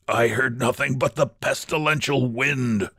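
a weary rasp, held down; hoarse. Acted. Exhausted Georg answers Ulrich, mildly hoarse. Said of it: "said Georg hoarsely".

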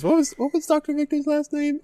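very high goofy voice; dim high voice. Acted. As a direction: squeak it out like an airhead. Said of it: "like, Neil Patrick Harris".

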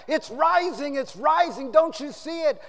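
high, loud, urgent cry; acted alarm. Editor warns his puzzled workers, loud and urgent. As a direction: call out as if raising the alarm. Editor shouts from atop a chair.

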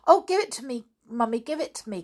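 put-on high, urgent child's plea; pleading girl's cry. A narrator's reading. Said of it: "cried Janet".

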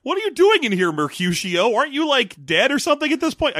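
high, startled actor voice; surprised character. Pretend. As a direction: act caught off guard. Acting surprised Romeo scene.

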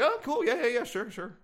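lightly voiced polite boss; imitating Hassan's diplomatic reply. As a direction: chirp it politely. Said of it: "He was like".

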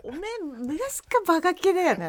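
high, laughing Akita dialect. Pretend. Teased classmate retorting at reunion, laughing Akita dialect. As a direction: hit back playfully in Akita dialect. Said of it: "ちょっと秋田弁で話してみたい".

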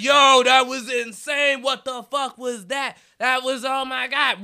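high, slow amazed praise; hyped fan. Retold or imitated. Fans hyping him after the set, high slow amazement. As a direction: gush like a stunned fan. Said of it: "hyping me up like".

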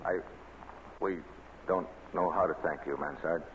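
soft, hushed gratitude; murmured thanks, voice dropped right down. Acted. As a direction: keep it low so no guard hears. Whispered thanks during secret meeting.

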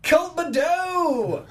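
high, drawn-out welcoming shout; excited shout. Real, not acted. Host greeting their media manager on air, excited shout. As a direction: holler the name out long and high. Host hails a known caller.

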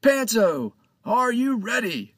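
put-on high, loud hail to a boy close by; captain shouting at Panto right in front of him. A narrator's reading. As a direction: shout as if across the deck. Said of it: "he shouted".

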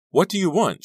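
high, loud desperate question; shrieked, panicky demand; frightened cry. Acted. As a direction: cry it out in fear. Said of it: "she cried".

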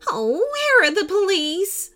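high, wailing hostess voice; theatrical woman in distress; distraught woman. A narrator's reading. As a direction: cry out in a high, melodramatic voice. Distraught woman hostess calls for police.

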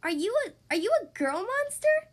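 high, halting, rising question; stumble, then blurt it high and loud; startled disbelief. A narrator's reading. Child notices the monster's bow, startled and incredulous.

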